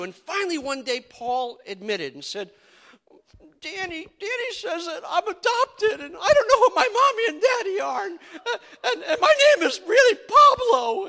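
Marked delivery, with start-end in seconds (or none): 3.6-11.1 put-on high, loud sobbing child voice; imitating a bawling kid brother; crying brother. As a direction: blubber it out like a crying little boy. Brother confessing, crying, retold.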